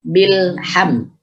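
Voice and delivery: "Bilham" with lam closed before ham; clean stopped lam. Shown as a demonstration. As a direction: go straight from l to h in "Bilham". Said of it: "langsung ke huruf selanjutnya".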